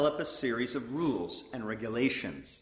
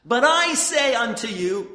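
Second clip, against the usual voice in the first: loud, high and solemn; King James proclamation; 8 semitones higher, 9 dB louder than usual. Retold or imitated. Preacher voicing Jesus on the mount, loud and solemn. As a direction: proclaim it like Jesus in the old Bible. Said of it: "King James there".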